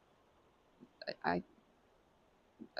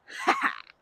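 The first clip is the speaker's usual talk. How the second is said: high bursting laugh; laugh as throwing; 6 dB louder than usual. Real, not acted. Hurling the rock for her friend, high laugh. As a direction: let a squealing laugh out on the throw.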